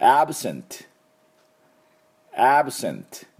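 "absent" said clearly twice, ending on a clean t; crisp model of "absent" for students to echo; repeat after me. Shown as a demonstration. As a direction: say "absent" and stop on the t. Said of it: "私の後について発音いきましょう".

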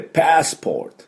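crisp teacher's demo of "パスポート" in English, pitched high; English pronunciation. Shown as a demonstration. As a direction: model "パスポート" as English passport, open "a", r-coloured "or". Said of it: "パスポートの正しい発音は".

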